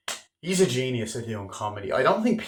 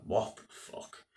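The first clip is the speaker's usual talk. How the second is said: whispered in disbelief; stunned whisper; 9 dB quieter, slower than usual. Real, not acted. A viewer reacting to the wife walking out, stunned whisper. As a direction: breathe it out, stunned.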